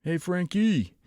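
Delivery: high, hammy Brooklyn greeting; Brooklyn accent. Pretend. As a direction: call out to him Brooklyn style. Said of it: "talk to him in, like, a Brooklyn accent".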